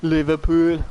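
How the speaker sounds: Scouse twang on Liverpool; Liverpool accent. Shown as a demonstration. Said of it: "thought I was from".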